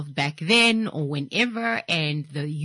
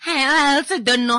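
baffled; 9 semitones higher, 6 dB louder than usual. Retold or imitated. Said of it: "You're like".

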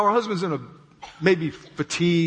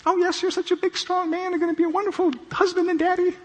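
much higher sweet falsetto gushing; adoring wife. Retold or imitated. Wife gushing over her new husband, mock falsetto. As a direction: coo up at him in a mock girly voice. Wife's early adoring words.

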